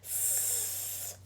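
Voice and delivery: long hissed s, teeth close together; drawn-out s like a snake. Shown as a demonstration. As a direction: hiss the S like a snake. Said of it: "we're gonna sound like a snake".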